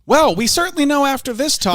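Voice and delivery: wry news-anchor closing; Tucker Carlson sign-off. Acted. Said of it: "Tucker Carlson".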